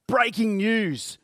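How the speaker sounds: mock-urgent news flash, stretched out slowly; news report announcer. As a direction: imitate a newsreader hyping a headline. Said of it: "news reports and they go".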